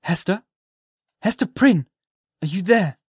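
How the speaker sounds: calling her name, high and a little loud; suppressed call. Acted. Minister calling to Hester in the dark, half-held call. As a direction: hold the voice back but still call to her. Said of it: "Then, suppressing his voice".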